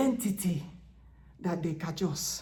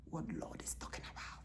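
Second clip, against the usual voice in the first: confiding whisper to the flock; 2 semitones lower, 13 dB quieter, faster than usual. Real, not acted. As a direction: lean in and whisper it. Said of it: "unvoiced whisper".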